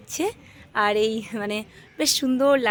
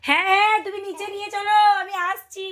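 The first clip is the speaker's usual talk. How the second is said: calling out; 10 semitones higher than usual. Real, not acted. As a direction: call back to someone further away. Calls to someone in another room.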